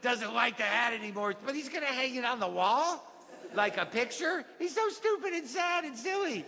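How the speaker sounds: put-on high, singsong little-kid voice; child retelling a storybook. Kid sharing the favorite book mom got, childlike storytelling.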